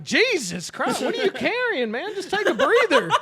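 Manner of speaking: high, exclaiming comic disbelief; mock exasperation. Son reacting to dad's laboured reply, mock exasperation.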